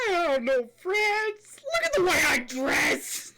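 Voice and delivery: high, loud, laughing voice; laughing mock whine. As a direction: shout it while cracking up. Retelling predator's answer.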